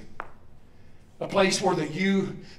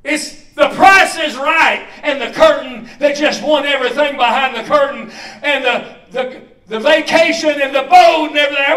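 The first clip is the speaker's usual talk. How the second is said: excited game-show retelling; 7 semitones higher, 12 dB louder than usual. Retold or imitated.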